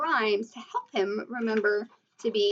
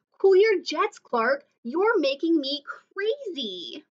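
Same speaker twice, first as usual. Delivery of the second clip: exasperated friend; 5 semitones higher, slower than usual. A narrator's reading. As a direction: tell him to calm down, at wit's end. Annoyed friend snaps at Clark.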